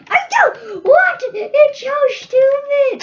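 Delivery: high, loud, slow yell of "It's so stupid", half laughing; laughing frustrated yell. Real, not acted. Gamer yelling at the game, laughing in frustration. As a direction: let the frustration burst out with a laugh.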